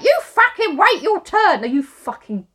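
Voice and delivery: loud, high, drawn-out scolding; acted furious rant at someone jumping the queue; mock angry outburst. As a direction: stretch out an indignant telling-off, hammed up. Voicing angry people in a queue.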